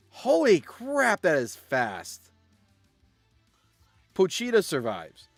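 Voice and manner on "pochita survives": usual speaking voice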